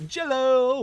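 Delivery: light up at the treat; excited exclaim. Real, not acted. Spotting the Jell-O cake.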